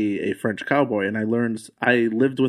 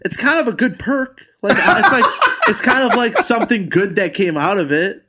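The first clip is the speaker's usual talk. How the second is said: laughing; 9 semitones higher, 4 dB louder than usual. Real, not acted. Laughing while telling Disney story.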